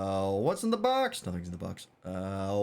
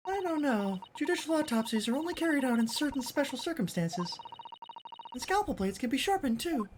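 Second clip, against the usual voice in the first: put-on much higher, polite young assistant; high girlish assistant voice; 14 semitones higher than usual. Assistant hoping the scent leads to the swindler, high and polite. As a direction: lift into a prim young lady's voice.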